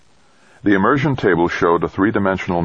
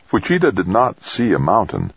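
ordinary delivery, nothing marked

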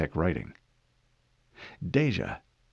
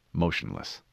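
usual speaking voice

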